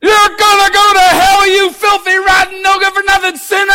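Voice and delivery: loud, high, shouted condemnation; preacher mocking harsh preachers, shouting; hellfire preacher. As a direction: rant like a fire-and-brimstone preacher. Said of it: "preachers saying".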